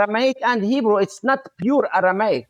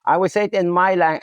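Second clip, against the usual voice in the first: ordinary talk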